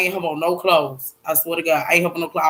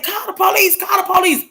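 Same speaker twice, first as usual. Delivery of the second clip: high urgent cry for help; retold panic; 10 semitones higher than usual. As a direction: re-enact frantic calling out, pitched way up.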